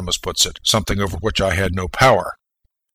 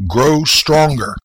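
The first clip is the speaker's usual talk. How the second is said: slow command; 5 semitones higher, 4 dB louder, much slower than usual. Retold or imitated. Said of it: "And I tell you".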